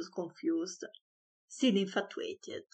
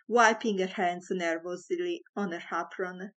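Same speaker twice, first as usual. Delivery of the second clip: ordinary delivery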